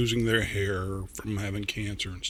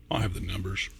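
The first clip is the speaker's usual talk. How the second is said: softly whispered to the viewers; whispered aside; 5 semitones lower, 7 dB quieter, faster than usual. Real, not acted. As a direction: confide it in a whisper. Aside about his rating score.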